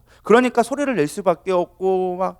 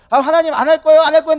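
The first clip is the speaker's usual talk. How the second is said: higher, louder protest; stubborn refusal; 7 semitones higher, 6 dB louder, faster than usual. Acted. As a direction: balk loudly at a command. Jonah refusing God's command. (하기 싫다고 버티는 말투)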